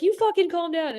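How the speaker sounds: very high-pitched, exasperated comeback; mock-mad retort. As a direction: throw it back in a squeaky mock huff. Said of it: "And I was getting mad".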